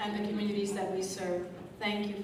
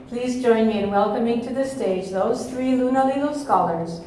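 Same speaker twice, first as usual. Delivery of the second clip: louder, projecting to the back of the room; big projecting voice; 8 dB louder than usual. Real, not acted. Host calling guests up to the stage, loud and projecting. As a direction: fill the hall without a microphone. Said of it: "So I have a really big voice".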